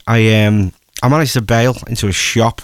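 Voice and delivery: usual speaking voice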